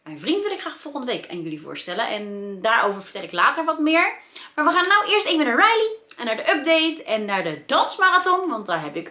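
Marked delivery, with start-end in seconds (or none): 4.5-8.5 excited. Real, not acted. Cheerful vlog announcement to viewers.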